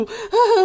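high breathy giggle. Real, not acted. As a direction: giggle at yourself. Laughs retelling crying. (高声咯咯笑)

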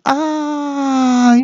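piercing high mock scream; landlady's scream. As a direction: shriek like a startled shop owner. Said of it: "老板娘就大叫说".

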